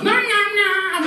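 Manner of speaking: put-on very high, drawn-out kid nom-noms; imitated chomping. Boy in his father's arms, high-pitched chomping. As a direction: squeal the munching noises like a small child. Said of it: "So the little boy ran in his arms".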